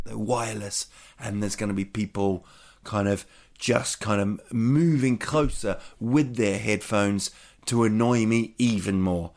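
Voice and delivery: ordinary delivery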